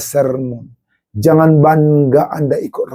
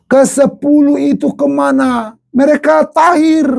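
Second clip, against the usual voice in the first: fiery preaching; 10 semitones higher than usual. Acted. Pastor scolds ungrateful churchgoers: fiery raised preaching. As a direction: preach it hard at the congregation.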